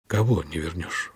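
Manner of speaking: slow, low, dazed question; low, sluggish and slightly lost; as if waking. A narrator's reading. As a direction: ask as though just coming to. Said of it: "вроде как очнувшись".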